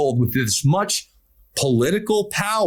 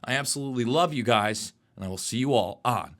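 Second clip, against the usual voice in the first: measured showman's sign-off with punched key words; broadcast sign-off; 4 dB quieter than usual. Acted. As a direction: sign off to the audience, swelling on key words then dropping softer. Show host's closing address.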